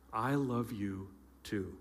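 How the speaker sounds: very quiet, low imitated reply; soft tender reply. Girlfriend saying the words back to her boyfriend, very softly. Girlfriend returns the first declaration.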